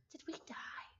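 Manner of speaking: hushed, breathy aside; whispered disbelief. Real, not acted. Streamer whispering to chat at game over, stunned. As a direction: whisper it in shock.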